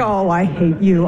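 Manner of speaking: low, slow loathing; seething anger. Acted. Wife to husband who ignores her, seething. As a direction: drag out HATE in a low voice.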